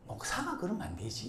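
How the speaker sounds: soft mock reproach; chiding wife. Wife to her pastor husband, soft chiding. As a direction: lightly imitate a needling spouse, softly. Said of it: "집사람이".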